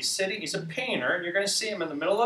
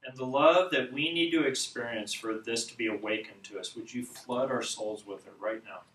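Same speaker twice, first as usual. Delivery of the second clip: measured prayer with long pauses; unhurried, reverent petition; spoken prayer; 3 semitones lower than usual. Real, not acted. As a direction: address God earnestly, pausing between phrases. Leads a prayer.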